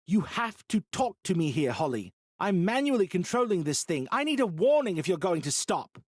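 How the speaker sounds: high, loud frustrated lecture; angry. Acted. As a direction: demand a warning, annoyed. Bo keeps scolding.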